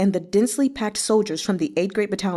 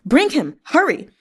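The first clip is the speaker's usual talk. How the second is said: higher, louder shouted command; officer yelling at his men to chase the rider; urgent shout; 6 semitones higher, 4 dB louder than usual. Acted. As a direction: bark it over your shoulder in a hurry. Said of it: "turned back and shouted".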